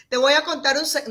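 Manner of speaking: "Te voy a contar un se-" announced loudly, no hush; how not to tell a secret; wrong way, too loud. Shown as a demonstration. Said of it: "yo no te voy a decir".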